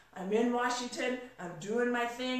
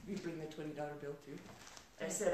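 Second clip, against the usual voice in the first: ordinary talk, nothing marked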